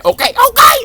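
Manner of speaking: squealed high and loud; "Okay, okay" up high; high excited okay. Real, not acted.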